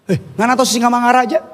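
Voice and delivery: fast, teasing Manado-style question; Manado quiz. Retold or imitated. Wife quizzes her Batak husband, fast Manado-accented teasing. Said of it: "orang Batak".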